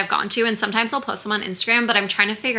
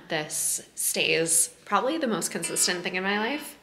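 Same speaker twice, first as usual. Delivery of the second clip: hushed, softer and lower voice; quiet at night; 3 semitones lower, 7 dB quieter than usual. Real, not acted. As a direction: keep it down while someone sleeps. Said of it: "I kind of have to whisper because Taylor's still sleeping".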